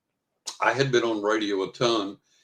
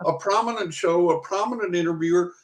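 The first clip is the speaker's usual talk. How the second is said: high, indignant protest; exasperated retold argument; arguing; 10 semitones higher than usual. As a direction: argue your case in raised-pitch disbelief. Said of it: "And I'm arguing with them".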